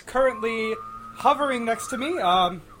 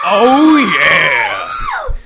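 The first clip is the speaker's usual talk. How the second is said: excited shout; 3 semitones higher, 10 dB louder than usual. Acted. Host hype shout.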